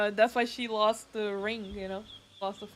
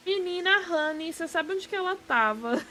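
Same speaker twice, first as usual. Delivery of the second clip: higher-pitched gossip in Brazilian Portuguese; 6 semitones higher than usual. Acted. Streamer voicing Brazilians gossiping about game characters, animated gossip. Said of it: "if you say their name with a Brazilian accent".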